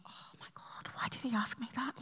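hushed breathless whisper of inner panic. Retold or imitated. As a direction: whisper it like a secret thought you can't say aloud. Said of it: "And then you're like".

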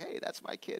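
put-on whispered, pleased "Hey, that's my kid"; proud claim. Parents proud of their grown child, hushed. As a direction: claim the kid with quiet pride.

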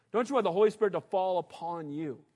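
ordinary delivery

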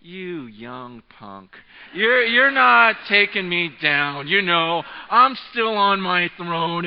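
defiant inner voice. Retold or imitated. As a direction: drag it out like an old champion refusing to fall. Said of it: "it's just this look of".

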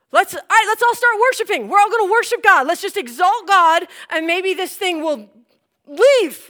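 high, loud and fast call to worship; rally the room in desperation; urgent rallying call. Retold or imitated. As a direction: pastor calling the church to worship.

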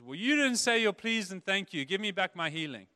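hammed-up indignant demand; mock demand. Imagined Jesus scolding the woman, mock petulant.

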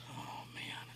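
breathless whisper; preacher whispering in awe; whispered oh man. Real, not acted. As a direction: hush it like a confession. Awed after recalling the voice.

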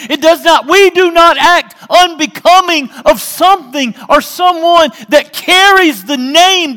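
preaching at full force. Acted. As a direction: thunder it out to the church.

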